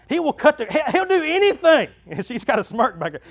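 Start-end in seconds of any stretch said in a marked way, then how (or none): 0.1-1.9 high, loud mock complaint; imitating wife's complaint. Wife telling church about her husband, mock complaint.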